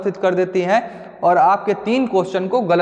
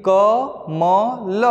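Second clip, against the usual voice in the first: very slow, each letter drawn out separately; much slower than usual. Shown as a demonstration. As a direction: say कमल as three long, separate sounds: क, म, ल. Spelling out the word letter by letter.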